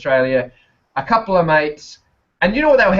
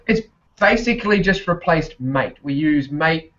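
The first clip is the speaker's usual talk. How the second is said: ordinary talk, nothing marked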